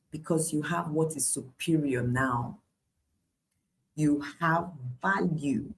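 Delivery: ordinary delivery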